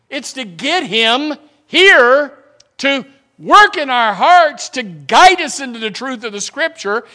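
loud, high, slow full-force preaching. Acted. Preacher building up on why the church sings. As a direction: preach it out to the rafters.

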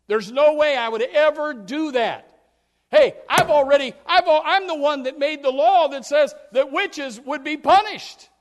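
loud, indignant brush-off; indignant denial. Acted. Saul brushing off the warning, loud and indignant. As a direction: scoff at the very idea.

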